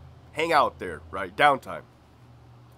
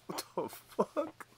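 much higher, slow disbelieving squeak; drawn-out stunned disbelief; officer baffled by partner, high slow squeak; 8 dB quieter, slower than usual. Retold or imitated. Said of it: "I'm like".

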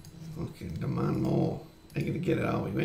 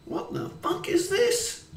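angry outburst; slower than usual. Real, not acted. Raging at the team.

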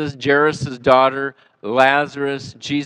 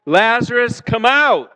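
louder and higher, calling out; hollering; 9 semitones higher, 3 dB louder, slower than usual. Shown as a demonstration. Preacher leads the congregation in a hollered call. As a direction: holler it together with the crowd. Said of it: "I want you to holler that with me".

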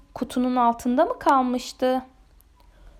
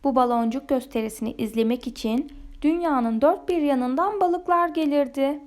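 usual speaking voice

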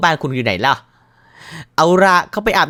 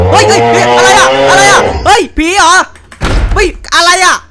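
loud panicked cries of what is it; panicked shouting; 4 semitones lower, 5 dB louder than usual. Real, not acted. Gamer shouting in fright as the ghost appears, loud. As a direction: yell in real fright at the ghost.

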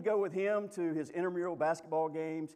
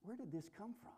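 puzzled; 7 semitones higher, 10 dB quieter than usual. Retold or imitated. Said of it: "look around and go".

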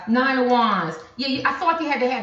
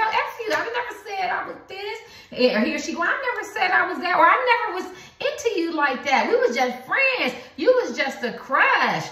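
dismissive partner; 9 semitones higher than usual. Acted. Voicing the partner brushing them off.

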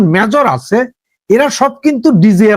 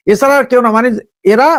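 ordinary talk, nothing marked

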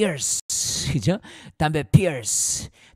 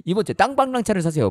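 fast, higher, louder salesman pitch; hammed-up TV commercial voice; mock ad pitch; 6 semitones higher, 4 dB louder, faster than usual. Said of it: "광고할 때 재밌게 치지". (과장된 광고 성우 말투)

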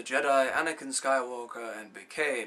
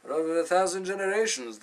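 raised, quick and higher; proud proclamation; 5 semitones higher, 9 dB louder, faster than usual. Acted. Mentor telling pupil of the Jedi, proudly proclaiming. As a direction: proclaim it with force. Old mentor recalling the Jedi's past.

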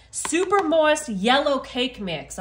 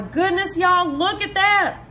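excited; 6 semitones higher, 5 dB louder, slower than usual. Real, not acted. Cake scooped out of pan.

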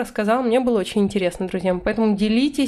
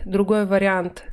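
ordinary delivery

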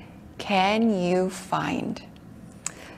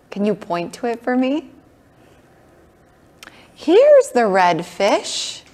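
ordinary talk, nothing marked

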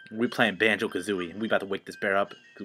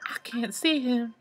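high character voice; 10 semitones higher than usual. Acted.

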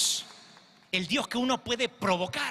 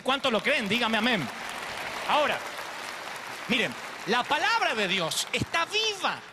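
fervent preaching; 3 semitones higher than usual. Acted. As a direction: build the appeal with growing fire. Preaching builds to full force. (predicación fervorosa que va subiendo)

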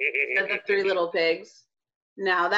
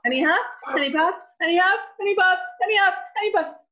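higher, faster and faster; excited; 8 semitones higher, faster than usual. A narrator's reading. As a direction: build up the huffing. Building huffing narration.

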